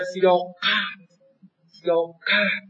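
"سیاقت" with the ق close to a ک; teacher's local pronunciation. Retold or imitated. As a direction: imitate a local accent's blurred ق in "سیاقت".

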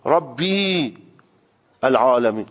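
ربی with a long drawn "ee", then a break before العالمین; wrong reading, ربی dragged out long; over-lengthened kasra. Shown as a demonstration. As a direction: stretch the i into a full ya, "Rabbiii, al-'alamin". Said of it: "بیای این رب رو اشباع بکنی".

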